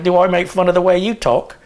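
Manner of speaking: imitated Australian accent. Visiting girl objects to being mimicked, imitated Australian accent. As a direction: throw it back in a mock Australian accent. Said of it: "this girl was visiting from Australia".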